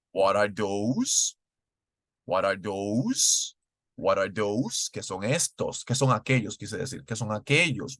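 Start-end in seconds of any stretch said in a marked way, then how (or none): 0.1-4.9 "What are those?" said slowly with a rising tune; slow model question. Shown as a demonstration. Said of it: "Último ejemplo".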